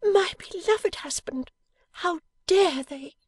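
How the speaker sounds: very high, slow, outraged wifely cry; high-voiced wife, fiercely protective; indignant outburst. A narrator's reading. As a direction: draw it out high in loyal indignation. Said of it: "in a burst of generous indignation".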